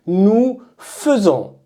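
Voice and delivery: nous faisons said slowly, each syllable clear; careful emphasis. Shown as a demonstration. Teacher insisting that students say faisons slowly. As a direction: stress faisons as fe-zon, slow and firm. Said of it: "J'insiste bien".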